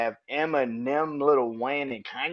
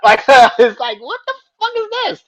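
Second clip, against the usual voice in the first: very high, loud laughing question; laughing disbelief; 13 semitones higher, 13 dB louder than usual. Real, not acted. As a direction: laugh it out in shock.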